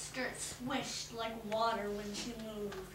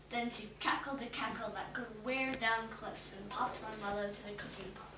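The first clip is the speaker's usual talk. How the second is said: plain reading voice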